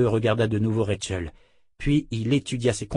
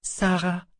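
put-on little girl; 8 semitones higher than usual. A narrator's reading. Rachel répond à la poupée.

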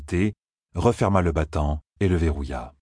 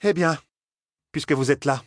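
high voice giving in with a sigh; resigned sigh; 8 semitones higher than usual. A narrator's reading. Aes Sedai accepting the uninvited companions, sighing high voice. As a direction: concede it with mild resignation. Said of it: "soupira-t-elle".

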